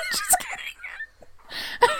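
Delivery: giggling it out; laughing. Real, not acted. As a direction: squeal through laughter, very slow. Laughing after swearing at France.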